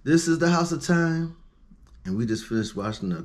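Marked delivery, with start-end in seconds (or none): 0.0-1.4 slow, louder announcer read; podcast host intro. Acted. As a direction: announce the show name. Show host sign-off.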